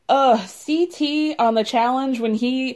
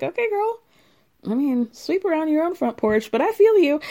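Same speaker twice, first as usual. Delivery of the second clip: high, sassy mock reply; 6 semitones higher than usual. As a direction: throw her some shade.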